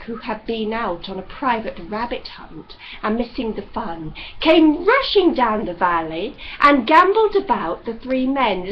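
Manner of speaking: ordinary delivery, nothing marked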